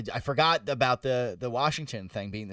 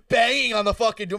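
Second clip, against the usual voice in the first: shouted emphasis, higher pitched; mock shout; 8 semitones higher, 5 dB louder than usual. Friend recalls hearing the door being pounded, mock shout. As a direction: shout out the banging mid-story.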